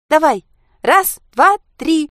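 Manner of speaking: put-on high, loud child's yell; shouted countdown. A narrator's reading. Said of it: "закричала первой Анька". (громко и звонко кричать счёт)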